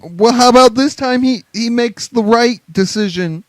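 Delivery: high, loud, stumbling speech; Steph. Acted. Mocking the boss's speech about Vince, high and stumbling. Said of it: "Let me flub these lines".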